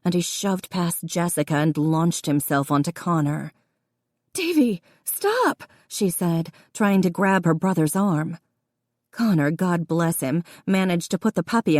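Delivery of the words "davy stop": much higher urgent call; sharp high cry. A narrator's reading. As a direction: cry out to stop him. Sister stops brother attacking.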